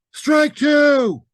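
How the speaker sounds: high, loud umpire bellow; umpire's shout. Acted. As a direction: call the strike at full voice. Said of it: "and the umpire said".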